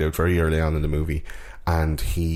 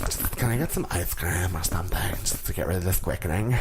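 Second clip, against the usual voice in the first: mock whisper; 5 dB quieter than usual. Immortal whispering a joke complaint, mock weary whisper.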